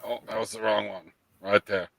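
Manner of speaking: ordinary talk, nothing marked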